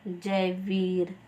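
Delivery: drawn out syllable by syllable; Jaiveer said very slowly, each syllable clear; slow spelled-out name. Shown as a demonstration. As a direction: stretch Jaiveer out slowly, as a teacher would. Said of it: "आप बोल सकते हो".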